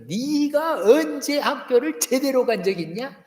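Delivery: high, slow scolding jab; a hint of a scornful parent; mock scolding parent. A parent scolding a grown child, mildly mock angry.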